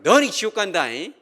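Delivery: loud, high, scolding threat; harsh condemning shout. Shown as a demonstration. Preacher condemning a listener, loud scolding shout. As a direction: bark it like a fire-and-brimstone preacher. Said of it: "이렇게 한 것이 아니라".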